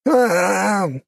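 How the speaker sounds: high loud scream of pain. A narrator's reading. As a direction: cry out in agony. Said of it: "закричал от боли Юнусов".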